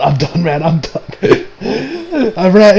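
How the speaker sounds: ordinary talk, nothing marked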